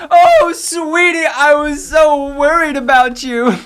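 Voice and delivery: high, gushing, fussing mother; worried mom. Acted. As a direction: draw out the relief in a high, loud voice. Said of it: "Wow, she's worried".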